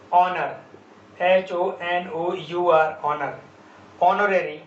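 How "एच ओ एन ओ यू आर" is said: slow letter-by-letter spelling; teacher spelling it slowly; spelled out slowly. Shown as a demonstration. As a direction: spell each letter out deliberately. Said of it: "spelling letters slowly".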